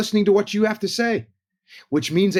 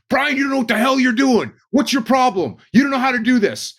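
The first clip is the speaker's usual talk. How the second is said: higher, louder angry scolding; yelling boss; 7 semitones higher, 4 dB louder than usual. Retold or imitated. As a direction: tear into the worker like a hostile foreman. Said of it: "started yelling and screaming".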